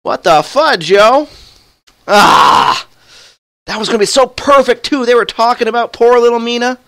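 high, loud frustrated yelling; raging gamer. Real, not acted. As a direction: rant at the unfair game death. Raging at game failure.

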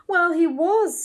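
a lightly voiced proud host. A narrator's reading. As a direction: announce it like a family boast. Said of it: "in a proud tone".

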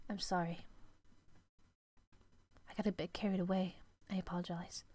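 hushed sorry from a strict maid; apologetic maid. Acted.

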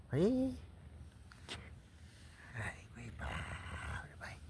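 soft cooing pet talk; cooing at a dog. Real, not acted. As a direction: coo lovingly at the dog.

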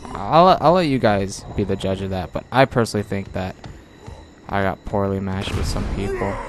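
ordinary delivery

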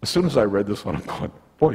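ordinary talk, nothing marked

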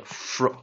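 "fr" sounded as one blend; blended onset. Shown as a demonstration. Teacher demonstrating the onset, "fr" blended in isolation. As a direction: say "fr" alone as a joined sound. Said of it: "所以你就念fr".

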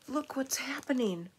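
ordinary delivery, nothing marked

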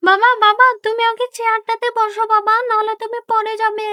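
high, sincere pleading; earnest daughter. Acted. Daughter urges mother that God sees everything, high and sincere. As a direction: appeal to her conscience.

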